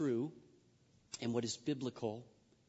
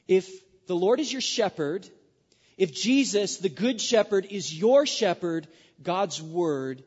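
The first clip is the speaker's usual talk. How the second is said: raised, louder preaching appeal; 5 semitones higher, 9 dB louder than usual. Acted. Preacher urging the congregation, loud and raised. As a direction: lift the voice and press the congregation.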